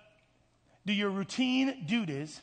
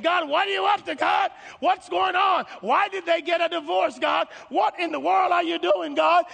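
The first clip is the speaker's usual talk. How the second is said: high, loud cries of protest at God; frustrated shouting; 10 semitones higher, 9 dB louder than usual. Acted. Child of divorce demanding answers from God, frustrated shouting. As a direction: shout your bewilderment up to heaven. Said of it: "you're getting frustrated with life".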